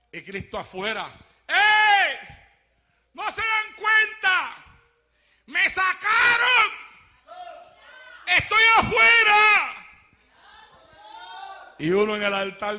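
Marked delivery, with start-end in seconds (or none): 1.5-9.9 high, loud, slow outcry; Christ shouting. Acted. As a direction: shout to be noticed, left outside.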